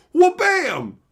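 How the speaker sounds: loud high shout; sound-effect shout. Pretend. Streamer hamming up a reality shift for chat, loud shout. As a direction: slam it out like a comic sound effect. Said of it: "Altered reality".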